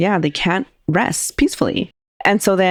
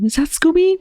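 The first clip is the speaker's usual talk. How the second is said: pitched way up, lightly voicing the host; high retold question; 11 semitones higher than usual. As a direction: ask it high and rising, half-mocking. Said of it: "Flavio tells him".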